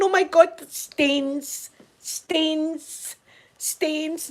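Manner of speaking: high, loud gasp of alarm, then fading; alarmed old mum. Retold or imitated. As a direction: imitate a flustered mum fussing over stains. Mum panics over top's stains.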